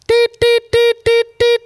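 high electronic beeping; imitated alarm beeps. Garage door alarm going off, high mock beeps.

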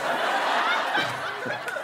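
airy wheezing laugh; breathy high laugh. Real, not acted. Laughs at own joke.

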